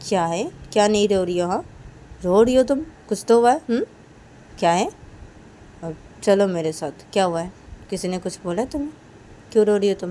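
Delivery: insistent Kajal. Acted. Kajal pressing crying Reshma, loud. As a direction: push her to say why she cries.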